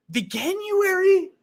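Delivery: high, stretched-out disbelieving question; incredulous. Real, not acted. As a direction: squeak the word out slowly in disbelief. Disbelief at the word.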